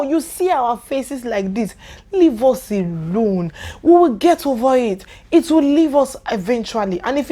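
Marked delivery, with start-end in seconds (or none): none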